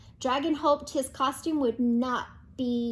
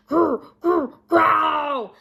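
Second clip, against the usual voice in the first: loud rumbling growl; louder growl; 4 semitones higher, 9 dB louder, much slower than usual. A narrator's reading. Growl closing in on dragon, loud and rumbling. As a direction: let the beast growl closer. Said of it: "The growling got louder and louder".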